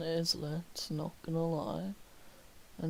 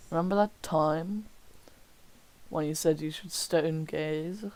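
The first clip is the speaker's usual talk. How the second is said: normal talking voice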